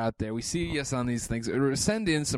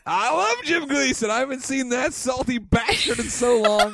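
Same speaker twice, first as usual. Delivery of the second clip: higher, louder, slow laughing half-sung gush; gushing excitement; 10 semitones higher, 4 dB louder, slower than usual. Real, not acted. Fan gushing over Jim Gleason, laughing excited gush. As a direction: stretch every word out with delight.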